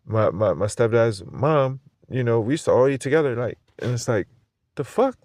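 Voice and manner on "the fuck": high-pitched incredulous squeak. Real, not acted. Vlogger reacting to shrunken cans, high disbelieving squeak. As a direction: shoot up in disbelief.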